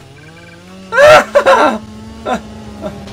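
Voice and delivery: scared laughing out loud; high loud frightened laughter; loud nervous laugh. Real, not acted.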